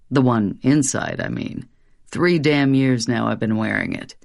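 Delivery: Satan, low and dark, sly; a devil sneering at his disguise; low demon voice. Acted. Satan continues.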